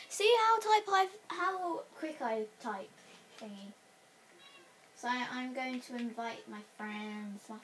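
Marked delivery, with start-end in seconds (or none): 0.1-2.9 1 boastful. Real, not acted. Boasting about typing.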